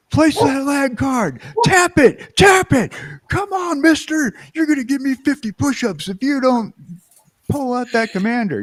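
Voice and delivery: barking, high, loud drill instructor; drill sergeant. Shown as a demonstration. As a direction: yell it like a drill sergeant at boot camp. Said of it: "yell at somebody and go".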